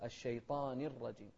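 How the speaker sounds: الشيطان joined as -ni to الرجيم; clear kasra. Shown as a demonstration. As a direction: make the i after الشيطان distinct. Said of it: "نوضح الكسرة". (الشيطان بكسر النون بوضوح)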